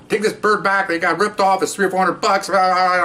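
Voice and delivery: high-pitched mock rant of an angry wife; mad wife. Wife demanding the bird go back, mock mad. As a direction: nag on high and fed up, imitating her. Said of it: "she's all mad now".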